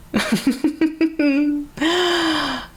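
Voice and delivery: a bright real giggle at the absurd title. Real, not acted. Reading out the Mancala title, a real giggle. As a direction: laugh at the story you're about to read.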